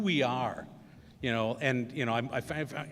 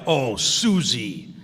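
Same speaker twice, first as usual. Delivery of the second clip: slow, louder, drawn-out put-on voice; hammed-up drawn-out blame on the girl; mock blaming; 9 dB louder, much slower than usual. Brother in the back seat blaming Susie, slow hammed-up voice.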